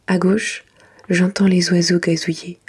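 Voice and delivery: plain reading voice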